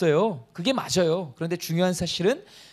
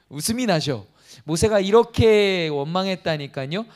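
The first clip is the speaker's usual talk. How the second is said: ordinary delivery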